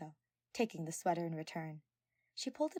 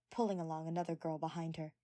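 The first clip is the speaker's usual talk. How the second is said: ordinary delivery, nothing marked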